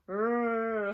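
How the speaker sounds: growl. Acted. Disguised figure growls.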